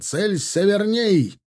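high, drawn-out aiming order; shouted command. A narrator's reading. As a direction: call out the target, slowly and high. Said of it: "прокричал егерский капитан". (протяжный высокий выкрик команды)

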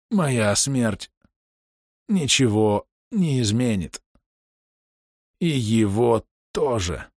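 slow, choking on blood; coughing up blood. A narrator's reading. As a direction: groan it out, gurgling. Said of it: "прокряхтел, захлёбываясь кровью".

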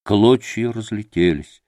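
ordinary delivery, nothing marked

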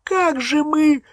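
very high, drawn-out squeal; panicked squeak. A narrator's reading. Hermione asks Harry how they will manage, panicked squeak. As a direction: squeak the question, stretching it out. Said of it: "запищала Гермиона".

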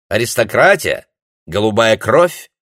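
camp it up with scorn; sassy drag queen. Acted. Gerti mocks aristocracy. (насмешливо-жеманно, громко и высоко)

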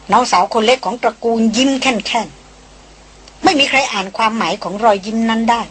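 ordinary delivery, nothing marked

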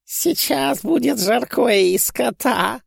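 high, slow gleeful cackle; gleeful giggle. A narrator's reading. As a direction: giggle it out with glee. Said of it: "радостно захихикал Волконский".

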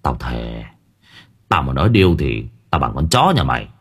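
gruff-toned village elder vowing; old man swearing. A narrator's reading.

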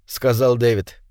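plain reading voice

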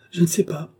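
queen's first anxious cry, pitch up; frightened cry. Acted. Marie-Antoinette refuses the dauphin's reassurance, a touch of panic. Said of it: "s'écrie Marie-Antoinette".

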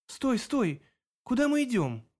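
high urgent stop cry; alarmed call. Acted. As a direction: call out to halt him. Pulls free, demands where they go.

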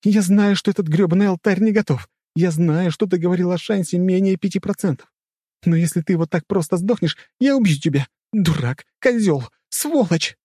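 high-pitched, loud heroine yelling at her dying man; frantic high shouting; desperate screaming. A narrator's reading. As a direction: scream threats and insults out of desperation. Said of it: "кричала Рэй, прижимая к себе умирающего".

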